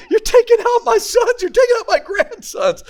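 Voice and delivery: high laughing complaint; high mock outrage, half laughing; upset mom. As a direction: mom protesting he's clobbering her sons. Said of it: "You know".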